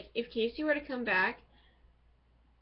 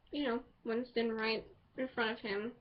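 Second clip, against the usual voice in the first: ordinary talk, nothing marked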